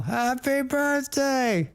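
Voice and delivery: high, slow call down the hall; yelled down the hall. Retold or imitated. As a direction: stretch it out like calling another room. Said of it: "I just yelled down the hall".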